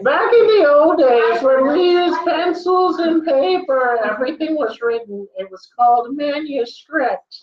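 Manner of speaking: put-on old person voice. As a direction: play an old-timer recalling pencils and paper.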